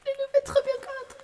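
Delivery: high loud French voice, "fait" stressed; French accent. Pretend. As the French smoke detector: high French accent. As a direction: say "Le loup fait trop bien grade" up high in French style. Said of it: "French accent".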